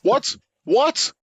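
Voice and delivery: squeaky high mock surprise; mock disbelief.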